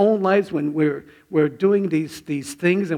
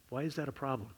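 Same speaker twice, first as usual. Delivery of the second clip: soft inner voice; 14 dB quieter, faster than usual. Retold or imitated. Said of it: "sense in the spirit, like".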